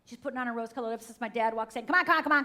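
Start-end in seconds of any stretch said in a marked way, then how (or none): 1.8-2.4 loud, higher-pitched hurrying dad; impatient dad. Retold or imitated. Dad walks in on the women getting ready, impatient. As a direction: bark it like a dad rushing everyone.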